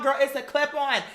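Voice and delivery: ordinary delivery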